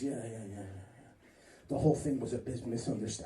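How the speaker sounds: lightly voiced friend waving it off; a touch of a sheepish prisoner; low mumbling inmate. Inmate friend brushing off question.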